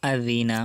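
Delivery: আযিনা with a soft dh sound; the zal in আযিনা said soft, dh as in this. Shown as a demonstration. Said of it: "জাল নরম হবে". (আযিনা, জাল নরম উচ্চারণে)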